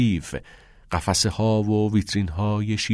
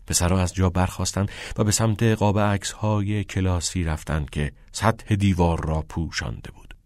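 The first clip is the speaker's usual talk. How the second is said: usual speaking voice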